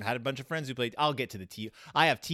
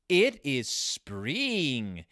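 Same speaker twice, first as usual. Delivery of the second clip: very slow, drawn-out storybook reading; overly earnest read-aloud voice; mock audiobook reader; much slower than usual. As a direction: stretch every word like a children's audiobook narrator. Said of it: "the guy who, ... an audiobook reader".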